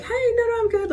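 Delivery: way up high and bright, overdone; overly friendly high voice. Shown as a demonstration. Showing how she overdoes niceness to coworkers, very high and chirpy. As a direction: chirp it to sound extra nice. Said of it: "over, like, emphasize my words".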